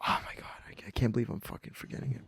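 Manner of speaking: hushed muttered frustration to himself; whispered aside, annoyed at his own memory; frustrated whisper. Real, not acted. Whispering to himself, annoyed he can't recall her name.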